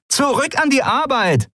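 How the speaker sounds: high-pitched, bossy yelling; shouted orders. A narrator's reading. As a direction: holler orders at the crew. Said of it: "rief die klapprige Frau".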